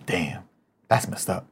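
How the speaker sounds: deadpan quiet dismay, lightly imitated; Gus from Psych. Shown as a demonstration. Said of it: "your best Gus impression from Psych".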